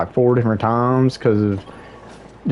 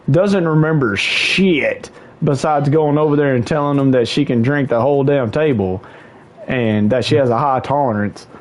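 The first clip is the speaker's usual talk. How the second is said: normal talking voice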